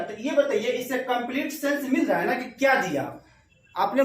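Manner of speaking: ordinary talk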